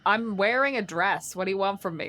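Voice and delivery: usual speaking voice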